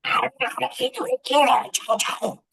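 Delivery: high, squeaky mimic of a pouting three-year-old; mocking granddaughter. Grandpa mimics granddaughter, high and squeaky. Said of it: "So I'm like".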